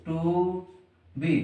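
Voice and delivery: normal talking voice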